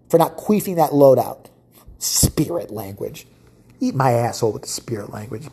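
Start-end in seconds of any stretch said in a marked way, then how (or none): 2.0-3.3 mocking repeat. Mocking the fan's excuse.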